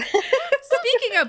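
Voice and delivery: slow, laughing segue. Real, not acted. Host to guest, laughing as she moves on. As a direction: chuckle through the show's motto.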